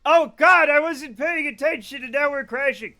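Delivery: panic. Real, not acted. Plane crashing mid-flight, panicking.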